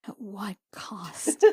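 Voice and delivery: whispered and slow, stretching what; hushed mock gravity after the table jokes; mock dramatic whisper. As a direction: breathe it like a grim secret.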